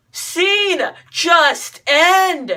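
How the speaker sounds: fed-up inner cry; exasperated plea. Retold or imitated. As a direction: plead loudly for it to be over. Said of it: "and I was thinking".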